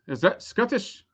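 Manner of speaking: tentative stab at a Scottish accent; lightly put-on Scottish lilt; attempted Scottish accent. Shown as a demonstration. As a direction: try out a Scottish accent, questioningly. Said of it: "do an accent like this".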